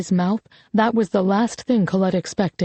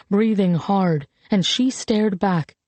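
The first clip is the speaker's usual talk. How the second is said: usual speaking voice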